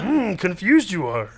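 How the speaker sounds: slow, gravelled alien sage; Yoda. Shown as a demonstration. Teasing confused co-host, slow sage impression. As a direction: draw it out as the little sage. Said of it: "mm, Yoda".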